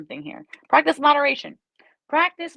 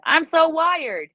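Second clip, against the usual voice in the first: much higher and louder, slow and buzzing; wired; 6 semitones higher, 5 dB louder, slower than usual. Shown as a demonstration. Reader demoing the highest energy score, buzzing and loud. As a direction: pop the words up like you're buzzing with energy. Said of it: "most amount of energy I can have".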